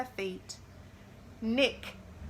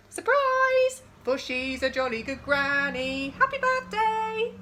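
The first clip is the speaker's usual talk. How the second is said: much higher, louder joyful cheering; party shout; 4 semitones higher, 6 dB louder than usual. Acted. Party guests cheering at Lily's door. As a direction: shout it like a surprise party crowd.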